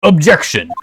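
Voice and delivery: loud, forceful courtroom call; shouted objection. Acted. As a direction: slam it like a lawyer. Courtroom objection after nerd take.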